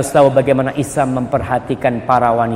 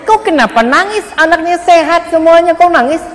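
high, raised voice of a puzzled doctor; doctor's voice; 5 dB louder than usual. Acted. Doctor asking the crying mother why she weeps, high and raised. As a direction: ask like a baffled doctor, pitched up. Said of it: "Kata dokternya".

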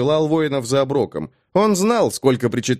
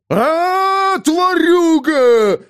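high, loud, drawn-out roar; wild yell; 5 dB louder, much slower than usual. Acted. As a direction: scream it out wildly. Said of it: "дико заорал".

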